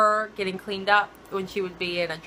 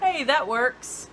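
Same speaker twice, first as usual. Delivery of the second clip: bright, raised mock realization; playful character imitation; 4 semitones higher, slower than usual. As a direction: act out the characters catching on. Same quote continues.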